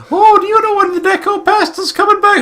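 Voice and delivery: put-on very high, loud child voice; little girl voice. Godchild asks the babysitter when mommy returns, high child voice. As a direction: squeak it like a little kid. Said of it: "Started saying weird things like".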